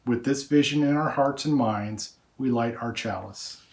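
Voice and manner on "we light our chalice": normal talking voice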